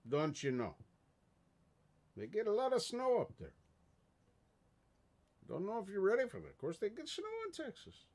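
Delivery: high-pitched, put-on Minnesotan voice; Minnesota accent. Streamer teasing a Texan moving north, mock Minnesota accent. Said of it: "that's the best accent I got".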